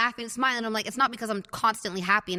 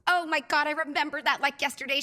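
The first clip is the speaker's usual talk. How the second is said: higher-pitched, delighted retelling; imitating amused mom; 6 semitones higher than usual. Mom remembers the bed story, mock amusement. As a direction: act out a mother tickled by the memory. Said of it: "She starts cracking up laughing".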